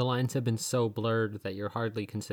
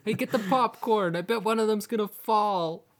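much higher mocking call to friends; jeering Bedin; 9 semitones higher, 5 dB louder than usual. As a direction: call the others over to laugh. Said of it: "just like".

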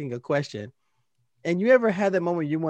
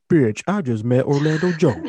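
loud, thrilled outburst, a touch louder; thrilled exclamation; 6 dB louder than usual. Retold or imitated. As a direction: blurt it out like you can't believe your luck. Excited retelling of meeting star.

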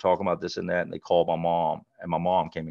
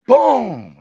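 sudden shouted boom, much higher; loud boom; 13 semitones higher, 12 dB louder than usual. Retold or imitated. Telling how the gym teacher slammed him, loud shout. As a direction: punch out the impact word.

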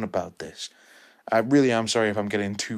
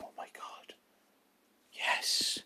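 hushed, breathless whisper of joy; whispered delight; 9 dB quieter, slower than usual. Retold or imitated. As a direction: whisper it like you can't believe it. Retold thrilled thought while watching.

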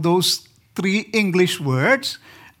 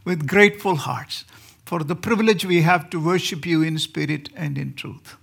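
prayer; 4 semitones lower than usual. Acted. Opening prayer before the sermon.

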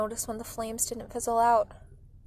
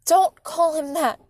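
loud, slow, higher-pitched protest; raised-voice rebuke; yelling; 5 semitones higher, 9 dB louder, slower than usual. A narrator's reading. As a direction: yell back in a mother's defence. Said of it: "Mom yelled".

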